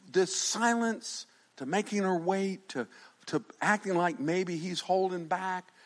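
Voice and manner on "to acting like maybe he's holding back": normal talking voice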